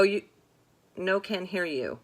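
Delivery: ordinary delivery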